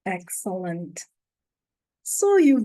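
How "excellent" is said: ordinary delivery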